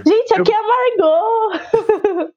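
slow laugh-crying plea. Real, not acted. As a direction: laugh through exasperation. Exasperated laughing.

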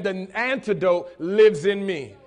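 slow, loud, emphatic declaration; with conviction. Shown as a demonstration. Preacher has the congregation tell their neighbors, with conviction. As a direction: proclaim it slowly and with conviction. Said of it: "you gotta say that with conviction".

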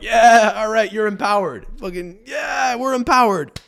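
high, loud bro approval; mock dude voice. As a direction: egg her on like a lad. Imitating men.

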